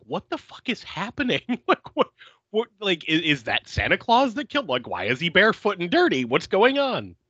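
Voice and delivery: high-pitched mock disbelief; incredulous, half-laughing questioning; bewildered reaction. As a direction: replay your baffled outburst, pitch climbing. Acts out first bewildered reaction.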